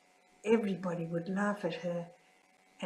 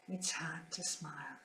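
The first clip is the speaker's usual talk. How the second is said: barely audible whispered repeat, much softer; fainter whisper; 3 semitones lower, 10 dB quieter than usual. Real, not acted. Nun grieving at sister's passing, fainter whisper. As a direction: let the whisper almost vanish.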